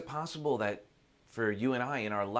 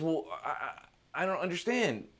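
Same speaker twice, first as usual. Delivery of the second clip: halting, raised-pitch confusion; baffled; 6 semitones higher, faster than usual. Acted. Seeker cannot understand the sage's trick, baffled. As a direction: stumble into a high, bewildered protest.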